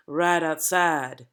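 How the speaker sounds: right outside, drawn out slowly within a narrow range of notes; slowed for emphasis. Shown as a demonstration. Coach showing how the accent emphasizes words, slowed down. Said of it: "slow down to use emphasis".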